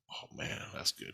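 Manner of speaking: a breathless whisper, almost no voice; whispered aside. Retold or imitated. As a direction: whisper it to yourself while staring. Ogling coworker, inner reaction.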